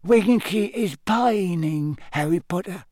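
high, slow, sorrowful elf voice; sad elf whisper. A narrator's reading. Dobby confides to Harry about Winky, sadly. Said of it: "Dobby whispered sadly".

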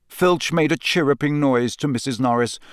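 ordinary delivery, nothing marked

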